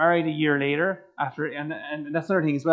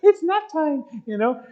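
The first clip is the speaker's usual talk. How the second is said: high laughing aside; high-pitched laugh through the words; 11 semitones higher than usual. Real, not acted. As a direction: giggle the words out. Laughing joke about lying down.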